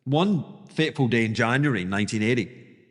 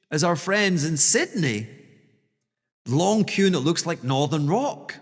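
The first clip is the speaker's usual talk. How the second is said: normal talking voice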